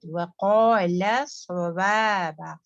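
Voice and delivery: व क़ाल with heavy क़ and light ल; heavy क़ाल, then light सवाबा. Shown as a demonstration. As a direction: recitation demo. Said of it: "स्वाद heavy".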